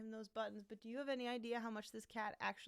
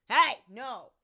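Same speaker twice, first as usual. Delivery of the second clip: loud sharp scolding no; 20 dB louder than usual. Real, not acted. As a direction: bark a loud no at the cat. Scolding cat on chair.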